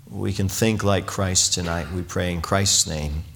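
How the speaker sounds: prayer. Acted. Pastor praying over the congregation, low and reverent. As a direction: lead the church in prayer, low and unhurried.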